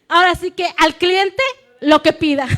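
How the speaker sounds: broma más fuerte entre risas; suéltalo riendo. Real, not acted. Broma, se ríe. (broma riendo)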